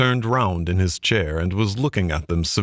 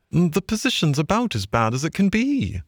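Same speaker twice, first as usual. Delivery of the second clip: high-pitched grumbling; grumpy Mole; 9 semitones higher than usual. A narrator's reading. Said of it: "replied the mole grumpily".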